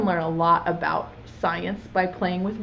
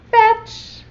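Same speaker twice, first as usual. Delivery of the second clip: bright, much higher called-out command; playful dog call, higher and louder; 12 semitones higher, 7 dB louder than usual. Acted. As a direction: call it out like to a puppy. Calling the whale like a dog.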